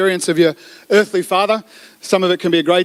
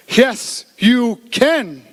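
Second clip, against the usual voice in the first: each word set apart, pitched higher; really slow speech; 5 semitones higher, much slower than usual. Acted. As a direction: answer slowly, one word at a time. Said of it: "just speak really slowly".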